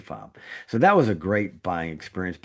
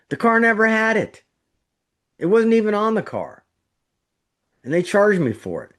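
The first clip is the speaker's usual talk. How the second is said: loud and much higher, outraged; indignant outburst; 12 semitones higher, 5 dB louder than usual. Real, not acted. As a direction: blurt it out in real indignation. Recalling being cheated by dealer.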